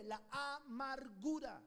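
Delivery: "La amargura" drawn out slowly, weight on "amargura"; slow emphasis. Shown as a demonstration. Preacher answering his own question, "amargura" drawn out slowly. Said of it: "cuál fue?".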